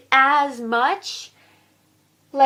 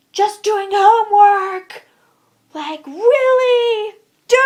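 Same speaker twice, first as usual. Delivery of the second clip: high, loud, drawn-out outrage; exasperated shout; 8 semitones higher, 10 dB louder, slower than usual. Real, not acted. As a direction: yell each word slowly in disbelief. Exasperated homework rant.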